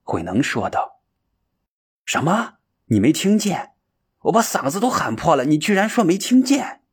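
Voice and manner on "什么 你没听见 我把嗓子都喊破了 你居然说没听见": 音调很高，越说越激动; 气急地反问; 惊愕质问. Acted. 山子不敢相信. (不敢相信地大声质问)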